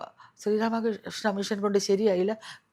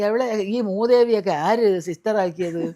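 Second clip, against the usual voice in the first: louder, mock indignant retort; scornful retort; 2 semitones higher, 7 dB louder than usual. A confused patient mocks the nurse in the ICU, louder scornful retort. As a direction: snap back at the nurse in scorn.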